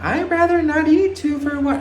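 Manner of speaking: hammed-up high princess voice; Kylie impression. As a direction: put on a very high, prissy celebrity voice. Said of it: "How does Kylie talk?".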